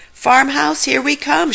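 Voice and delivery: high, loud tumbling call; giddy yell; excited shout. Acted. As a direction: shout while rolling downhill. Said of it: "Shaggy shouted".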